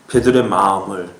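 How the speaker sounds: usual speaking voice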